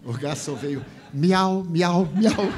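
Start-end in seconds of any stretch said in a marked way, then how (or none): 1.1-2.5 higher, louder laughing meows; loud mock meowing. Waiter mockingly meows back, loud and high. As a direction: meow back loudly as a joke.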